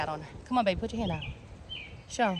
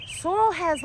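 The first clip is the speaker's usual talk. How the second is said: normal talking voice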